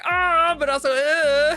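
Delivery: loud, high yelps of joy and dismay; mixed groans. Retold or imitated. Reacting to misogynistic gendering, loud high yelps. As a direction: squeal then groan. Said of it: "you're like".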